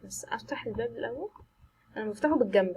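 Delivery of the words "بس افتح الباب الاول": tense soft whisper; whispered nervous instruction. Real, not acted. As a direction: hush it before opening the door. Scared player opening door.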